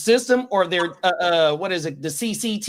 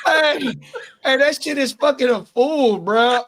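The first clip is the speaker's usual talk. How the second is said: higher and louder, laughing through it; laughing exclaim; 6 semitones higher, 4 dB louder than usual. Real, not acted. Friend reacting to the prank, loud and laughing. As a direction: still cracking up.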